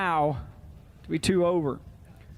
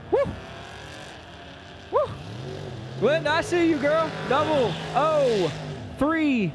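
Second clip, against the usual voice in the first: excited cheer; 7 semitones higher than usual. Real, not acted. Cheering on a racer.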